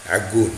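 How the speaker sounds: "Agoun" avec un ع guttural au début; prononciation algérienne d'Agoun. Shown as a demonstration. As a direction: dire "Agoun" en commençant par ع. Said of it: "même le A, tu ne le prononces pas". ("Agoun" prononcé à l'arabe, ع initial)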